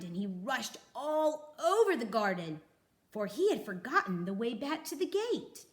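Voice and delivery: usual speaking voice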